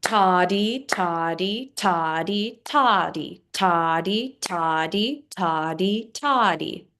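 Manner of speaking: two quick syllables per beat; rhythmic toddy count. Shown as a demonstration. Teacher counting eighth notes, rhythmic toddy. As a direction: chant toddy in even eighths. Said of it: "It can be counted out like this".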